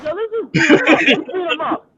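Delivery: loud, high egging-on, half laughing; hyped-up friend. Retold or imitated. Friend urging a beating on the kid, fired up. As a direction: act out a buddy itching for a fight.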